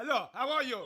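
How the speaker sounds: a German greeting that sounds like a telling-off; hammed-up harsh German accent; mock aggressive German. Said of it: "their language just sounds aggressive".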